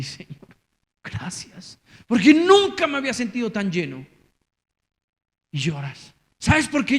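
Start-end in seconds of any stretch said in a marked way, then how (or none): 0.0-1.8 hushed whispered thanks to the Lord; whispered prayer. Retold or imitated. Worshipper whispering thanks to God, hushed. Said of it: "Así". 2.1-4.0 joyful outcry. Retold or imitated. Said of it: "Así".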